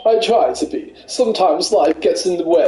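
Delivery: a light posh runner voice. Acted. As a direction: play a smug posh jogger. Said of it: "you do sound a bit arrogant".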